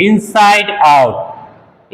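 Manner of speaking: stretched-out, careful teacher delivery; slow emphasis for students; slow key word. Shown as a demonstration. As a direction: present the key word slowly. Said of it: "ये है main word".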